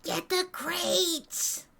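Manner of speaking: high, drawn-out battle yell; in-character yell. Acted. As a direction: call it out high, stretching every word. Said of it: "yell, like".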